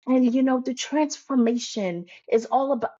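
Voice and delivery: mock coach-speak. Coach mocking other coaches' buzzwords, in exaggerated coach-speak. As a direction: send up a preachy coach, pitched high. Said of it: "I hate coach speak".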